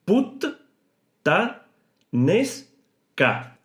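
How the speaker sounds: "put-ta-nes-ca" very slow, split between the two Ts; syllable by syllable. Shown as a demonstration. Teacher dividing the word into syllables for learners, very slow. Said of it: "vamos a dividir en sílaba".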